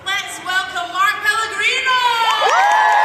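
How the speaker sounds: loud, high, drawn-out announcer shout; announcer introduction. Real, not acted. Host introducing a guest to a cheering crowd, big announcer shout. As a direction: bellow the guest's name, stretched and rising.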